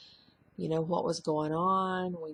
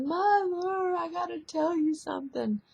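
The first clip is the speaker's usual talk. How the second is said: high, tearful daughter's voice, pitched well up; imitating crying daughter; 12 semitones higher, 4 dB louder than usual. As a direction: mimic her weeping as she breaks the news. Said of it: "and she was crying".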